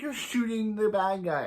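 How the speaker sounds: high, exasperated retort; hammed-up exasperation, pitched high; mock scolding. Hero spells it out to his partner, mock scolding. Acted quarrel over a shooting.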